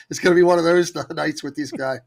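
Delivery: amused, laughing through the words. Real, not acted. Retelling his doubts about a newcomer in the chat, laughing. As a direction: let chuckles break into the line.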